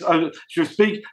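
normal talking voice

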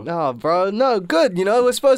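high and loud, overcome; earnest, caught up in feeling; emotional. Real, not acted. Comforting friends, still emotional.

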